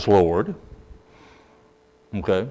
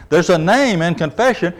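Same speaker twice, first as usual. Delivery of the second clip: higher pitch, leaning on "name"; stress on name; 6 semitones higher than usual. Shown as a demonstration. Preacher repeats the point for listeners, stressing "name". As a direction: make "name" stand out from the phrase. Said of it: "I emphasize this word name".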